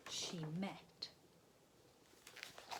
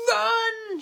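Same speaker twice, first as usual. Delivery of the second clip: very high, loud shout; 18 dB louder than usual. A narrator's reading. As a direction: yell run. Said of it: "shouted Mum".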